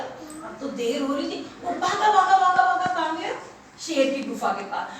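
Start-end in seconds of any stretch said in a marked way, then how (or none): none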